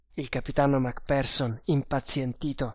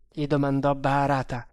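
ordinary delivery, nothing marked

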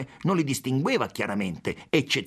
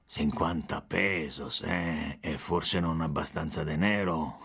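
low, softer jeering drawl; drunken mockery; 6 semitones lower, 4 dB quieter, slower than usual. A narrator's reading. Policeman scoffing at the consul's fifty pesos, low drunken mockery. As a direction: sneer quietly like a tipsy cop. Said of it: "in tono sguaiato, canzonatorio, ubriaco".

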